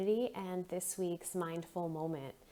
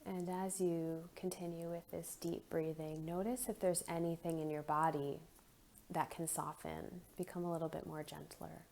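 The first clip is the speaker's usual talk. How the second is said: soft, unhurried meditation voice with long pauses; guided meditation; 6 dB quieter than usual. Acted. Leads a guided breathing meditation.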